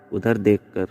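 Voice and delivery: plain reading voice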